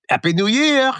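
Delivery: put-on high French-accented echo; mimicked French accent. Brother mimics the diver to his face, French accent. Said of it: "You just mimicked his French accent".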